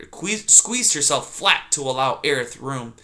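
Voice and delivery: ordinary delivery, nothing marked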